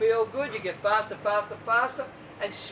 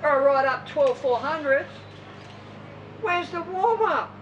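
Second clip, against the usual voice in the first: 1 indignant swimmer; 5 semitones higher than usual. Retold or imitated. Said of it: "They go".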